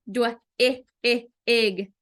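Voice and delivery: dwig broken into separate sounds dw-, i, ig; segmented phonics demo of dw-, i, ig; sounded out. Shown as a demonstration. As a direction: say the blend, then the short i, then ig, with gaps. Teacher sounds out a blend word.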